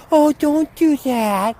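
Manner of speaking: high, slow, fretful put-on voice; mock fussy owner. Mocking owners who interfere with their dogs, high fretful put-on voice. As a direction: simper like a nervous dog owner.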